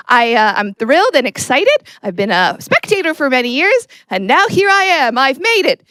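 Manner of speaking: ordinary talk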